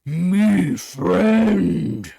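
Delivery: loud, drawn-out ogre bellow; deep monster voice. Acted. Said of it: "You hear a deep voice go".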